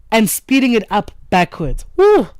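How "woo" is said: high, loud joyful whoop; excited whoop. Real, not acted. Host cheering a caller's inner-child message with a high, loud whoop. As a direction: let out a delighted cheer.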